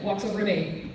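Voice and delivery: ordinary talk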